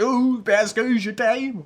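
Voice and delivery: put-on slow French with an American accent. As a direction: drawl it like an American singer. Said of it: "avec un accent américain".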